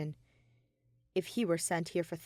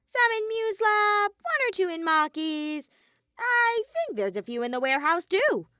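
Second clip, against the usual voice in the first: nonchalant listing; 12 semitones higher, 6 dB louder than usual. A narrator's reading. Monokuma listing explosives to Shuichi, offhand and high. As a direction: rattle them off without a care. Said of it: "Monokuma listed nonchalantly".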